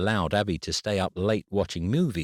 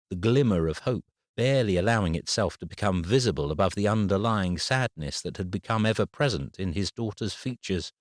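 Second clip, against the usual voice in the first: plain reading voice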